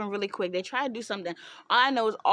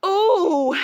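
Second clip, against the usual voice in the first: ordinary talk, nothing marked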